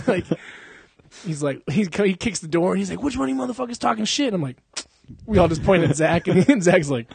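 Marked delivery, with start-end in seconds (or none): 3.0-4.3 mock angry demand. Kimbo kicks in the door on the podcast crew, mock angry. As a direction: play an angry fighter demanding answers. Acts out Kimbo confronting them.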